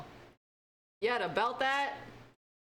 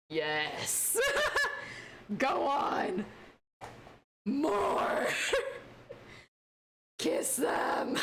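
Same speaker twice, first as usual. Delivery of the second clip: excited cheering; 7 semitones higher, much slower than usual. Real, not acted. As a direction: cheer the lovers on. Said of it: "I was like".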